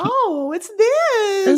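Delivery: very high, appalled mother; snooty poodle voice. Retold or imitated. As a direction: fake delight, pitch way up. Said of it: "Mother Poodle's voice went really high".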